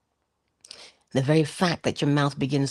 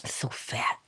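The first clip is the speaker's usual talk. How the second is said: whispered and much softer, disgusted with yourself; self-loathing whisper; 3 semitones higher, 6 dB quieter than usual. Pretend. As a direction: mutter your guilt under your breath while eating. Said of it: "with the attitude of".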